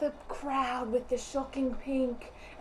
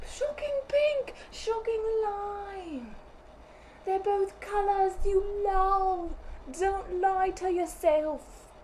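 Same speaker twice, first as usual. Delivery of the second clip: high, drawn-out promo call; hammed-up ad read; 8 semitones higher, 3 dB louder, slower than usual. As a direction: stretch it out high like a showy slogan.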